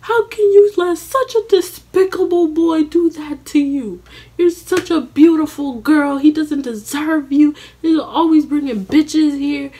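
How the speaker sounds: high, loud motherly scolding. Retold or imitated. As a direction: lecture the girl with pity. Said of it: "And she goes".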